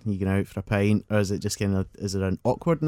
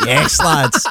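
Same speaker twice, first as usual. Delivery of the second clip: loud, higher mock Welsh swagger; mock Welsh bravado; cocky Welsh lad; 7 semitones higher, 9 dB louder than usual. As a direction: play a cocky Welsh lad shouting to his mates. Mocking cocky Welsh guys.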